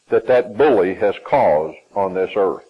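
usual speaking voice

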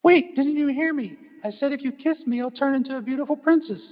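little frog voice. Acted. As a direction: pipe up like a tiny frog. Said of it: "And the frog says".

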